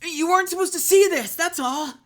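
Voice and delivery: put-on high, loud defensive teen boy; a guilty teen boy; hollow teen boy voice. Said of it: "that voice works so much better".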